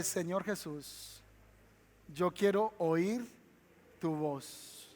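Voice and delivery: soft, slow hushed prayer; quiet prayer. Shown as a demonstration. Pastor leads congregation in prayer, softly and slowly. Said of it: "Levanta su mano un momento y dígale".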